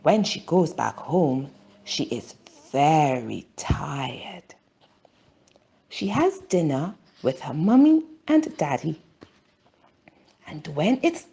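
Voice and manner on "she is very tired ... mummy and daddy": ordinary delivery, nothing marked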